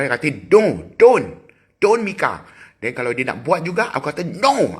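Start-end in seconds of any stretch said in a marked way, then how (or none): 0.5-2.5 high, loud re-enacted telling-off; scolding yell. Parent acting out scolding the child, high loud yell. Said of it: "Aku jerit macam tu". 4.3-4.7 high, sudden scolding shout; re-enacted yell. Parent showing how they forbid the child, high yell. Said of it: "Aku jerit macam tu".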